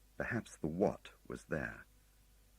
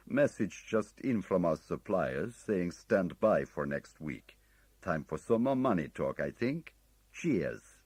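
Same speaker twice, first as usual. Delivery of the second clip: put-on slow, louder, breezy Oriental-accented message; casual Oriental-accented trader talking money; breezy Oriental accent leaving a business message; breezy, slight Oriental accent; 4 semitones higher, 7 dB louder, slower than usual. A narrator's reading. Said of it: "breezy English with a slight Oriental accent".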